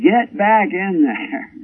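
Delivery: high, slow call without full volume; drawn-out, high-pitched mock holler; retold calling out. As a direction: order sheep back from far off. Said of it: "my voice had to travel all the way down".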